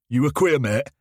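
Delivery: put-on sneering teen in a heavy local English accent; lightly voiced local English lad taunting. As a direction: push the thick local English accent, mocking. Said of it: "Heavy local accent".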